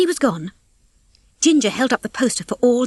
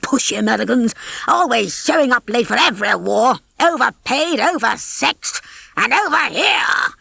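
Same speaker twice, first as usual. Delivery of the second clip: put-on muttering old rooster; 3 semitones higher than usual. A narrator's reading. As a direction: grumble with mounting disgust at Americans.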